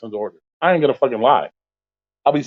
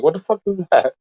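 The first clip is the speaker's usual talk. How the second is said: higher, incredulous retold yell; pitched-up what-is-this outburst; yelling at the phone; 6 semitones higher than usual. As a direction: react to a lowball offer in disbelief. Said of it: "Yelled at my phone".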